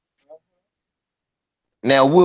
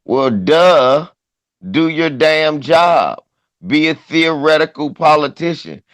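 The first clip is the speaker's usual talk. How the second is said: loud, higher exasperated scoff; exasperated duh; 8 semitones higher, 4 dB louder than usual. Retold or imitated. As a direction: scoff it like it's obvious. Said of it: "The first thing I thought was".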